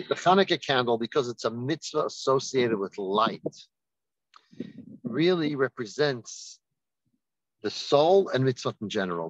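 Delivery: normal talking voice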